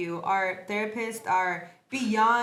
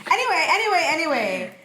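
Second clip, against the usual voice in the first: loud anyway; 6 semitones higher, 7 dB louder than usual. Real, not acted. Loud topic reset.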